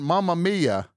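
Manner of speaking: high, loud hammed-up Italian exclamation; mock Italian accent. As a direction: burst out like a cartoon Italian.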